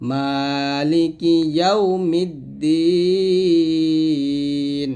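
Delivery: very slow chant, long held close; drawn-out tajweed recitation; recitation with a stop. Shown as a demonstration. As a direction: stretch the last syllable of "ইয়াউমিদ্দিন" and stop. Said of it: "যদি এখানে থামেন তাহলে বলতে হবে".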